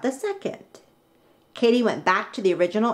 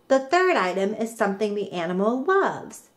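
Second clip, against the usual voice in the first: plain reading voice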